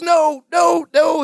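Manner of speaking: very high put-on protest; Robin Leach. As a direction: protest in a high celebrity-host impression. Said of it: "Robin Leach voice".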